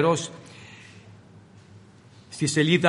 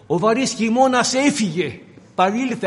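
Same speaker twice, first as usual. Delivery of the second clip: higher, fervent proclamation; preaching fervor; 5 semitones higher than usual. Acted. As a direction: announce the good news with fire. (ένθερμο κήρυγμα)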